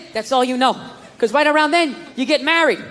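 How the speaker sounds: ordinary talk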